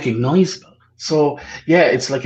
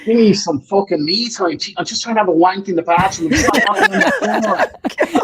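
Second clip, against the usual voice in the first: higher-pitched, laughing mock complaint; mock exasperated; 6 semitones higher than usual. To family knocking on the bathroom door, mock exasperated whine. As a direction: whine it like a put-upon guy wanting privacy. Voicing himself fed up with family.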